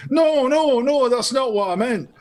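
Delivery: high, loud put-on Scottish accent. Joke-teller as customer correcting shopkeeper, mock Scottish accent. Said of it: "did you do that in a Scottish accent".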